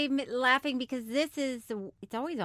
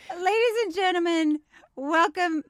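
louder, high opening, slow deliberate finish; announcer intro; 5 semitones higher, 5 dB louder than usual. Real, not acted. Host redoing the show intro after correction, big slow announcement. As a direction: announce it grandly, stretching out the title.

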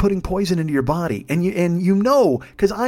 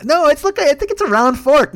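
high, loud airhead insisting; squeaky dumb model protest; ditzy model voice; 9 semitones higher, 4 dB louder than usual. Retold or imitated.

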